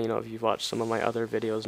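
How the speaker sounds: ordinary delivery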